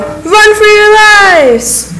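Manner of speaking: high, loud, long-stretched warning shout; drawn-out yell. A narrator's reading. Narrator voices someone yelling as David arrives, drawn-out high yell. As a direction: yell it out slowly, each word stretched high. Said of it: "he heard someone yell".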